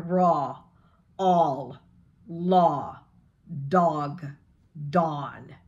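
dog and dawn in a light Midwestern accent. Shown as a demonstration. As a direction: Midwestern vowels, pulled back a little. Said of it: "you pull back just a little bit, so it's a Midwestern".

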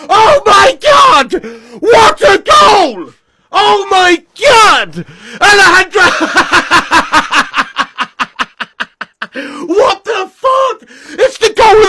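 ecstatic shouting. Real, not acted. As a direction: scream it in pure joy. Live goal reaction.

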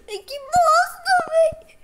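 high-pitched laughing squeal; squealing, helpless with laughter; crying with laughter. Real, not acted. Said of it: "Tô morrendo de rir".

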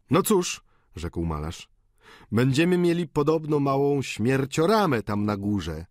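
lightly voiced mocking painter; joking painter. A narrator's reading. Painter joking to the boarders, lightly mocking. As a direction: toss off the dark joke.

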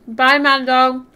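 talking through a mouthful; words round a bite of food; mouth full. Real, not acted. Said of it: "talking with my mouth full".

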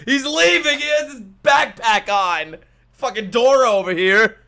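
high, loud exclaiming; loud outburst. Real, not acted. Viewer reacting to a character leaving with a backpack, loud outburst. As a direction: shout it out in disbelief.

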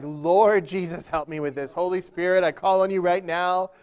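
loud, urgent plea to God; hammed-up emergency prayer at full volume; desperate prayer. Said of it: "And I prayed".

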